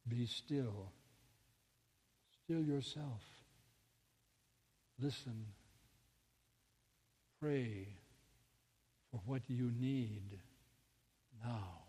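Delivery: very soft, low, long pauses; calm inner voice. Retold or imitated. Inner voice stilling a panicking mother, soft and calm. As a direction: hush it right down, spacing each instruction out.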